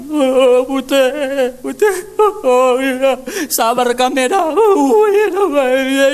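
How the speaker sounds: high, loud, drawn-out hammed-up wail; preacher mimicking paid mourners' loud high wail; mock wailing mourner. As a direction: play a hired mourner crying over the body.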